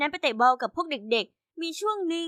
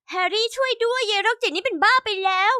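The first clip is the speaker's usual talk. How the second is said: cry for help; 6 semitones higher, 3 dB louder than usual. A narrator's reading. Bully calls Harry for help.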